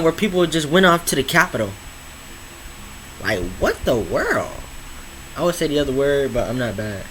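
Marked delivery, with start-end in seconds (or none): 3.2-4.5 disbelief. Real, not acted. Reacting to Capitol riot.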